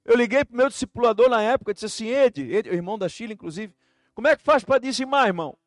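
loud, high, driving preaching; preaching at full force. Acted. Pastor telling the church his story, preaching loudly. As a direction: preach it with fire.

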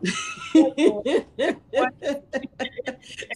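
high-pitched airy laughter, real. Real, not acted. Laughing at her own self-appointed title, real high laugh. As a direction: burst out laughing at herself.

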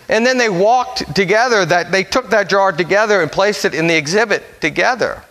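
ordinary talk, nothing marked

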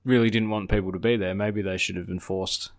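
usual speaking voice

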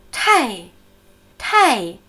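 Tai with an aspirated t, falling hard; falling fourth tone. Shown as a demonstration. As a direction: drop Tai down sharply. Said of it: "Vierte fallende Ton". (Tai mit viertem fallendem Ton)

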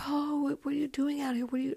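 high, surprised friends calling out from the car. Retold or imitated. Friends picking her up by the road, high surprised voices.

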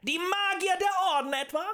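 very high, incredulous jeer; mock the very idea before a crowd; loud, sarcastic rhetorical question; scornful question. A narrator's reading.

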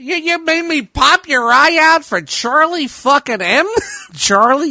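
high, loud mobster snarl; mock-menacing Pesci in Casino. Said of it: "I had to get my Pesci voice going on".

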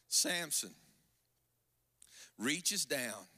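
ordinary talk

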